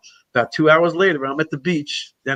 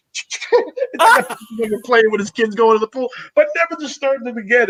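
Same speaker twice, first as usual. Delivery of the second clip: laughing; 5 semitones higher than usual. Real, not acted. Laughing at own story.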